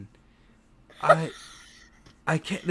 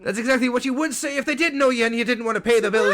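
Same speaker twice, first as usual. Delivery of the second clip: higher and louder, rapid scolding; indignant dwarf; 5 semitones higher, 4 dB louder than usual. Acted. Dwarf bartender accusing the elf of skipping, indignant and fast.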